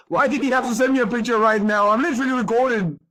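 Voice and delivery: very high crying wail; real crying. Real, not acted. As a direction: wail the complaint through tears. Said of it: "me crying".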